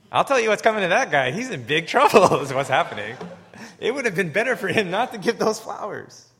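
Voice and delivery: loud, amused preacher's warning; loud laughing punchline. Real, not acted. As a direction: hammer the joke home to the room. Preacher's punchline about the husband.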